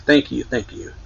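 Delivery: lightly imitated pleading gratitude; a mild retold plea, begging a little; hint of earnest pleading; pleading thank yous. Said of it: "pleadingly kind of thank yous".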